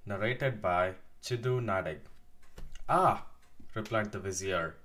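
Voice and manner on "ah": surprised. Acted. Vizier's startled exclamation.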